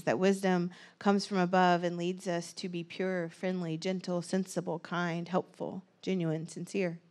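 soft, gentle meditative voice; calm reflection. Acted. Leads a quiet reflective pause.

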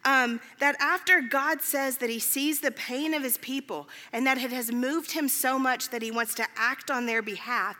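usual speaking voice

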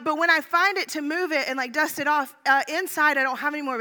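normal talking voice

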